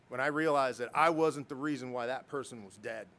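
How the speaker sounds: ordinary delivery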